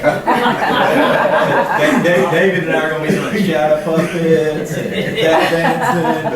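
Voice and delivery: laughing through a joke, high pitched and loud. Real, not acted. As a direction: giggle while you joke. Joking about shadow puppets.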